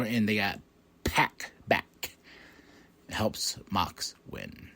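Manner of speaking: hushed, unvoiced secret whisper; soft, slow conspiratorial hush. Real, not acted. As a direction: drop to a whisper for the reveal. Dramatic reveal of Pac's return.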